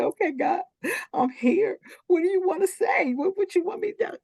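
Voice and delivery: high, weepy questioning; high crying voice asking God; tearful plea. Retold or imitated. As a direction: ask through tears, overwhelmed. Retelling crying before God.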